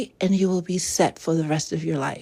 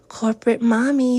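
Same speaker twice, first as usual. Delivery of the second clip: ordinary talk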